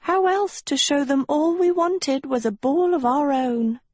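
high, mildly plaintive question; lifted voice with a touch of sorrow; sadly. A narrator's reading. Sophie justifying the ball to Agatha, sadly. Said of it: "Sophie said sadly".

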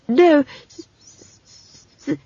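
high voice stuck hissing on S; stammering. Acted. Simon correcting Esk, stammering on S. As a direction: fight a stutter on the first sound.

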